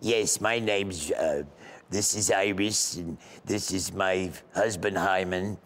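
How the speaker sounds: comic Boca retiree voice; old Boca lady. Acted. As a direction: introduce the family like an old lady caller. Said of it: "everybody from Boca that's seventy and up".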